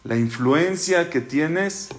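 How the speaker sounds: calm him gently, unhurried; gentle reassurance. Acted. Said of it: "Hashem le dice".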